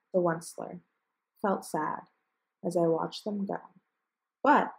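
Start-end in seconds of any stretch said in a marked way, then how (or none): 4.4-4.7 sharp loud high "But". A narrator's reading. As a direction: punch the opening word. Onceler turns defiant.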